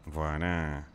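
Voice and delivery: lightly voiced gangster character, every line capped with hein; a hint of a grumbling hoodlum, ranting about snitches; slight in-character tough-guy voice; RP character Dove. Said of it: "je commence à être dans mon perso".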